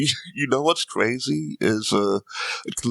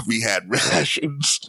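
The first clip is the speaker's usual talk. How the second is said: slow, deadpan lament; mock glum England; slower than usual. Imitating all of England answering America, slow and deadpan. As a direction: lay it out slow and flat like a grim wartime Brit. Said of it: "England's like".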